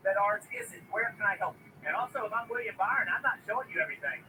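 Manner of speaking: normal talking voice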